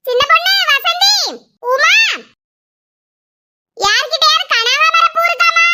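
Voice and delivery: high-pitched neighbourly call. Acted. Calling to neighbours for flowers.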